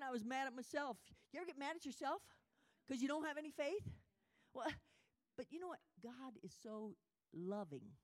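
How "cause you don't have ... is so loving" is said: ordinary talk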